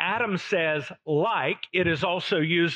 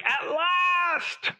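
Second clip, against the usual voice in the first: a high, delighted burst of discovery; joyful exclamation; 11 semitones higher than usual. Acted. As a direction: cry it out like a man finally finding his match. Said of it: "he exclaims in verse twenty-three".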